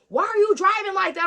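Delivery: high, loud acted challenge; demanding officer. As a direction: play a cop yelling at a driver. Acts out officer confronting her.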